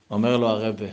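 usual speaking voice

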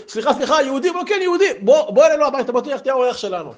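high, breathless invitation; voicing a thrilled host; eager villager. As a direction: pull him in warmly and fast. Townsfolk invite the guest eagerly.

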